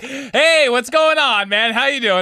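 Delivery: high, lightly voiced dad, cheery greeting; a hint of an upbeat father on the phone; imitating dad. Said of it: "he was just like".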